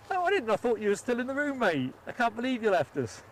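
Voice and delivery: bright delighted squeal of welcome back; excited greeting. Real, not acted. To a returning chat mate, delighted high greeting.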